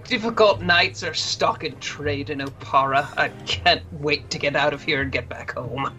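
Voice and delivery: a lightly voiced pained nobleman; hint of a weary old duke. Duke voice continues.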